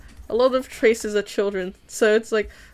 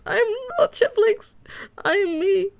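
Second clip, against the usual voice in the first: high squeaky voice; 11 semitones higher than usual. Pretend. Denies being the look-alike streamer.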